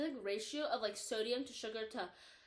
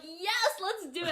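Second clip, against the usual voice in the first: eager, excited; 8 semitones higher, 5 dB louder, slower than usual. Retold or imitated. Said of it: "they're all like".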